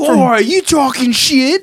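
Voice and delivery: high, slow mock British accent; mock British reader writing angry letters. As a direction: play an offended Brit. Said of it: "angry letters from the U K".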